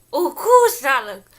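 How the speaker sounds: loud, high exclamation; surprised. Real, not acted. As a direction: burst out in shock. Reacts to something seen.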